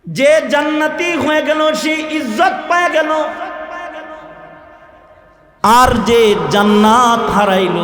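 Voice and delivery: high, ringing sermon delivery; impassioned preaching. Real, not acted. Preacher telling the congregation who gains honour, impassioned. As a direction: preach it out to the crowd.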